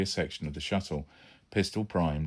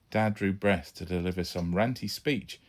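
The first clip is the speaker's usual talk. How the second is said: plain reading voice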